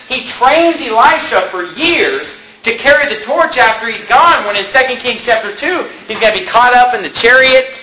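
climbing sermon finish; preaching. Acted. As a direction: lift the congregation with hope. Rousing close of sermon.

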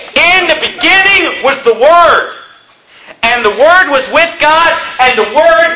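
high, slow, hammered scripture quotation; emphatic proclamation. Real, not acted. Preacher proves his point from John, emphatic proclamation. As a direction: proclaim each phrase with full conviction.

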